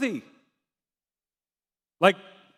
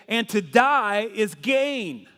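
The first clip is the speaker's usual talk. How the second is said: slow scripture quote; slower than usual. Retold or imitated. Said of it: "he was able to say".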